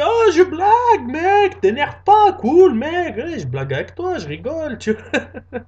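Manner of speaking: high, loud, slow panicked backpedalling; backing down fast from a furious guy; nervous backpedal. Acted. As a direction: laugh it off in a panic. Backing down, scared.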